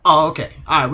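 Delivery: normal talking voice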